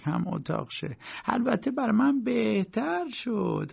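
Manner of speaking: put-on high, anxious old landlady voice; landlady pleading her innocence to her lodger; fretful landlady. Landlady Grubach voiced in story.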